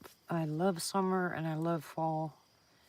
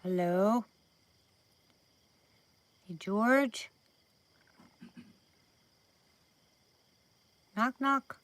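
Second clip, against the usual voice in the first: calling out; 5 semitones higher, 6 dB louder, much slower than usual. Real, not acted. Calling to the mouse.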